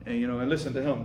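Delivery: usual speaking voice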